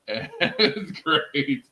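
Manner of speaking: laughing through the words; chuckling, slowed by laughter; amused laugh while praising a favourite. Real, not acted.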